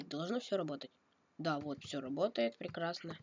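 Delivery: ordinary delivery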